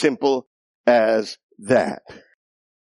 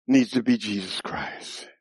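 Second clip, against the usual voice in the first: usual speaking voice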